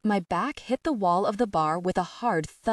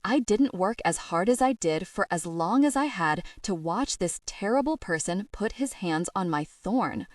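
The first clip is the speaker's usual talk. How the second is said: usual speaking voice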